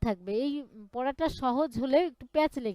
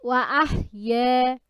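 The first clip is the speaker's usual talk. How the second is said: recitation for repeating; 5 dB louder than usual. Shown as a demonstration. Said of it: "বলেন".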